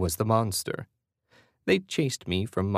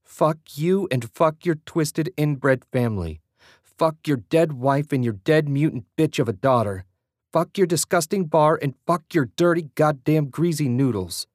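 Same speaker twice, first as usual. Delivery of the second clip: furious yell; 7 semitones higher, 4 dB louder than usual. A narrator's reading. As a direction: spit every curse out at him. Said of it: "Julie yelled".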